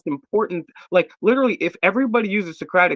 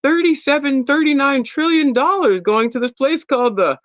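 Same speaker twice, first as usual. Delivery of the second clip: curious Reddit users; 8 semitones higher than usual. Retold or imitated. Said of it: "everybody be like".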